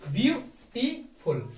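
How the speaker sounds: ब्यूटीफुल slowly, each syllable ब्यू टी फुल set apart. Shown as a demonstration. Teacher breaking the word into syllables for the class, slow and spaced. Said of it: "दो से अधिक ब्यूटीफुल".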